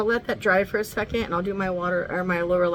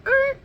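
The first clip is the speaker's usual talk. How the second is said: sudden high yelp; a quick high pained yip; imitated coyote yelp; 8 dB louder than usual. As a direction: squeal like a clipped pup. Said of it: "but I saw him go".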